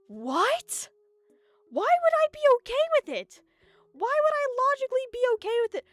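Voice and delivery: high, loud, incredulous protest; indignant outburst. Acted. Player protests to the villain who demands he die, high and loud. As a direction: throw her demand back at her, high and loud.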